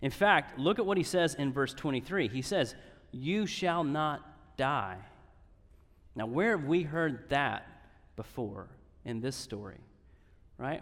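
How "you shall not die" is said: slow, weighty delivery of the promise; slow solemn pronouncement; solemn slow quote. Acted. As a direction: let each word land slowly. Quoting God's promise to Shammai.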